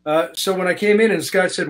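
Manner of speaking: normal talking voice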